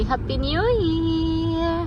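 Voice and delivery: high, long drawn-out greeting; coaxing demonstration. Shown as a demonstration. Mother prompting baby to say Happy New Year, high and drawn out. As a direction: stretch the last word for a baby to copy.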